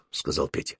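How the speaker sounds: ordinary delivery, nothing marked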